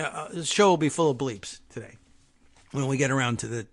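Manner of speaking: ordinary delivery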